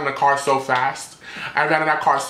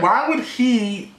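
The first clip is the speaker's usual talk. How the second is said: drawn-out, much higher why would he; incredulous; 8 semitones higher, much slower than usual. Retold or imitated. To the Uber driver doubting him, slow incredulous question. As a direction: stretch it out in disbelief.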